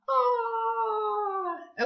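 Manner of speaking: "Ah" held long on a high pitch; vowel sound demo. Shown as a demonstration. Teacher repeats the sound for class, long high "Ah". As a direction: draw "Ah" out high and open. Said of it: "Do it one more time".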